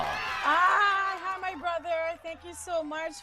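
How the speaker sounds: high, shouted hello; excited high call of hi; excited greeting. Real, not acted. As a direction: burst in with a happy high greeting. Guest greeting host on air.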